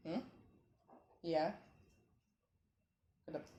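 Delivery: ordinary talk, nothing marked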